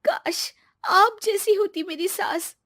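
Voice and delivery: put-on high, wishful young wife; high-pitched, flattering longing; wistful daughter-in-law. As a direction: sigh out the wish sweetly, pitched high.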